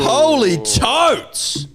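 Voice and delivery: high, slow, awestruck exclamation; drawn-out amazement. Real, not acted. Host unveils the tote bag, drawn-out amazement. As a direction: stretch it out high in wonder.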